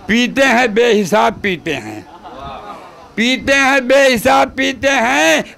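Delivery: slow, drawn-out mushaira declamation; poem recitation. Acted. Poet reciting verse to gathering, slowly declaimed. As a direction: stretch each phrase out for the crowd.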